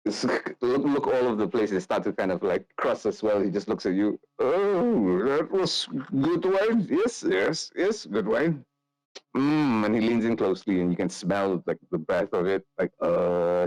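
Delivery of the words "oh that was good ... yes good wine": put-on higher, slow, merry drunk noble; tipsy Nikolai. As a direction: savour the wine, a little drunk. GM voices Nikolai.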